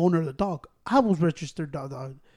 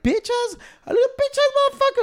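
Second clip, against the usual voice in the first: a much higher, squeaky mocking voice; mocking whiny voice. Mocking the man with the bandaged hand, squeaky insult. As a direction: sneer it in a whiny falsetto.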